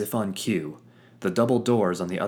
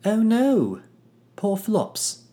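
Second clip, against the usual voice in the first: mock dismay; 7 dB louder, slower than usual. Fitz mourning the monkey.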